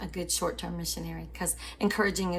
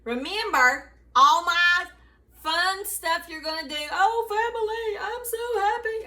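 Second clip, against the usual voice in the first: much higher, loud, bubbly holiday cheer; exuberant; 11 semitones higher, 6 dB louder than usual. Real, not acted. Wishing the family happy Thanksgiving, exuberant. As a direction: gush with delight.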